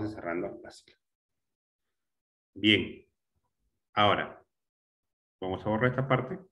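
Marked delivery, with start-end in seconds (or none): none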